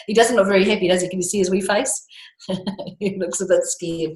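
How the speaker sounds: ordinary delivery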